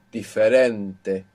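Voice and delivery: slow Diferente with the stress on "ren"; teacher demonstrating stress, slow Diferente stressed on "ren"; stress on second last. Shown as a demonstration. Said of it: "the natural accent will be on the penultimate syllable".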